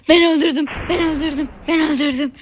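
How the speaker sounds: loud, high triumphant chant on ben; excited shouting. Real, not acted. Gamer boasting of a kill, shouting in triumph. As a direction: crow about your kill, hitting ben. Celebrating own kill in game.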